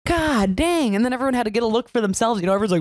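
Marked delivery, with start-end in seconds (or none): 0.1-1.0 high-pitched mock annoyance; fed up, pitched up for the story; retold exasperation. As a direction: act out snapping back at a partner. Retelling her exasperated reply to partner.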